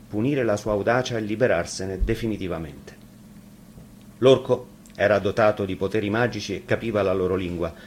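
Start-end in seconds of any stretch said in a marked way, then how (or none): none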